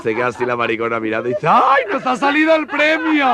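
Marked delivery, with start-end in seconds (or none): 1.5-3.3 very high, slow shriek of delight; camp fake delight. Caught shoplifter to the cashier, high slow fake delight.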